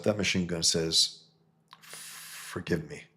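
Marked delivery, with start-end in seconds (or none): 1.8-3.0 quiet plea. Retold or imitated. Matt asks forgiveness before firing, slow and hushed. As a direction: breathe it out slowly, softly.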